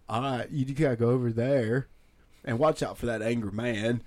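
stretched Southern drawl; earnest Southern drawl, leaning on each last word; Forrest Gump. Retold or imitated. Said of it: "we just talked like Forrest Gump".

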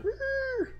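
little squeal, very high and quiet; high-pitched squeal. Retold or imitated. Retelling how Abed freaks out, high-pitched squeal. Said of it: "that little high-pitched squeal".

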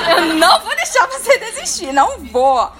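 loud, insistent repeats; emphatic vow; emphatic promise. Real, not acted. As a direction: hammer the refusal home. Promise not to let them quit.

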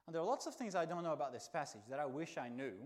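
ordinary talk

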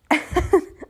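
bright laugh at her own joke; short giggle. Real, not acted.

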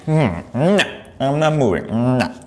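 mock a pouting child saying no; a hint of a sulky child; stubborn little kid. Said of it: "like a little kid".

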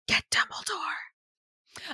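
slow, hushed stage whisper; urgent whisper. Acted. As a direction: whisper it as a grave order. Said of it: "and he's like".

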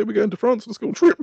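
high, bright announcement of the trip; cheerful teacher. Pretend. Imagined teacher tells the class about France trip, high and cheery.

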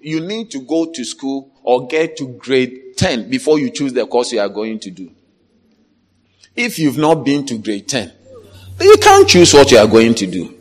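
ordinary talk, nothing marked

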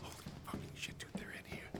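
breathless shocked whisper. Acted. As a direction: whisper in alarm while hiding. Hiding behind boxes.

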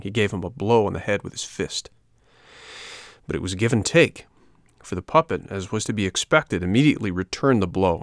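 plain reading voice

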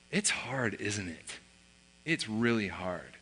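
low, hushed fatherly sympathy; quiet, tender understanding; retold empathy. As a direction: say it softly and slowly, to comfort. Dad empathizes with struggling son.

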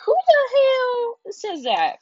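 high, loud, slow who the hell; shocked and disgusted; outraged disbelief. Real, not acted.

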